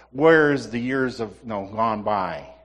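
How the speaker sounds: slow, drawn-out lament; slow mock lament of lost years; lamenting Gideon. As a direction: stretch it out as regretful Gideon. Voicing Gideon's regrets.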